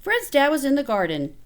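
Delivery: plain reading voice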